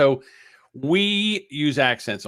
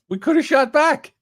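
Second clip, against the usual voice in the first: higher and louder, mocking the excuse; 13 semitones higher, 5 dB louder than usual. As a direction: throw the excuse back in a sneer. Mocking repeat of the line.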